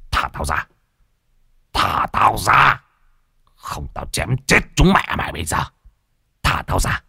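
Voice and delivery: rasping, menacing ghost in a man's body; possessed voice. A narrator's reading. Possessed man threatens those outside the locked room, rough menacing voice. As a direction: demand release in a rough otherworldly growl. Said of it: "pha cả âm lẫn dương".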